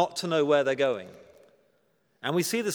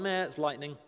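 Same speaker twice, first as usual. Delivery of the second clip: softer, unimpressed shrug in the voice; dismissive meh; 2 semitones lower, 5 dB quieter than usual. Acted. Crowd shrugs off God's voice from heaven, dismissive. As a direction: brush it off, underwhelmed.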